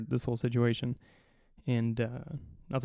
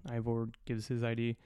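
usual speaking voice

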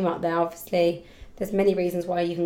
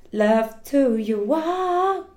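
affectionate; 2 semitones higher, much slower than usual. Real, not acted. Sign-off kiss.

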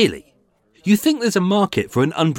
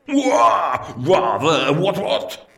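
braying upper-class toff, laughing; posh toff laughing; much slower than usual. Acted. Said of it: "posh toff laughing brag".

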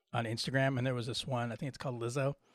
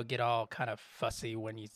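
normal talking voice

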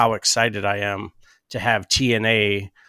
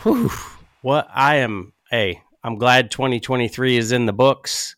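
ordinary talk

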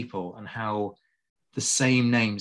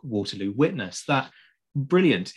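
ordinary talk

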